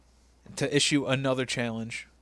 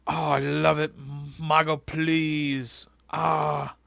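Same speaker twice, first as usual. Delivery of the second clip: slow, drawn-out mock swooning; JBL gooning; much slower than usual. Commentator gushing over the show, hammed-up ecstatic moaning. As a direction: moan it like an overexcited fanboy. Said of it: "J B L is gooning again".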